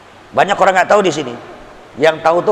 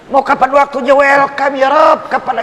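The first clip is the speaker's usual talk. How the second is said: fervent call to God; 8 semitones higher, 3 dB louder than usual. Acted. Preacher calls to God.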